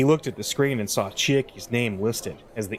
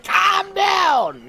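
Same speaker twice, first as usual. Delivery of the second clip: full-throated shout, much higher and louder; scream; 6 dB louder than usual. Shown as a demonstration. Streamer showing how the editor really screams it, full shout. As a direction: blast it out as the scream the line calls for. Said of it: "When you have to scream and be like".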